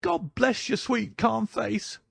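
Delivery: put-on very high-pitched old woman, overwrought; high, emotional old housekeeper; hysterical, tearful cry. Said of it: "cried with an hysterical sob".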